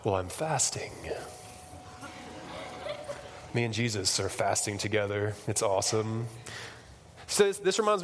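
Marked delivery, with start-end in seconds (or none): 0.0-6.3 mock pious sufferer. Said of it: "looking all terrible".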